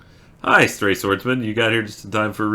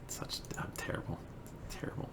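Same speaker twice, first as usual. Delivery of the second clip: barely audible self-reproach; whisper; 21 dB quieter than usual. Real, not acted. Embarrassed streamer after a failed poem reading, whispering. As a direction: whisper it to yourself.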